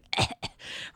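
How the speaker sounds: choking cough; coughing fit. Real, not acted. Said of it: "Oh, you're choking and shit".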